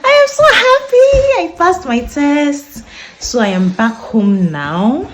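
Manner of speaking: very high, loud, slowed, overjoyed; joyful shout. Real, not acted. Announcing to viewers she passed her test, ecstatic shout. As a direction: shout the good news out in real delight. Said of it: "I don't want to shout".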